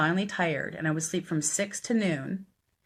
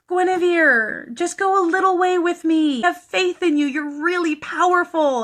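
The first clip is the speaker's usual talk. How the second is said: high, loud coaxing voice; mock begging, laid on thick; imitated wheedling plea; 12 semitones higher, 7 dB louder than usual. Billy begs and flatters her.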